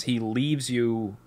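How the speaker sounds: ordinary talk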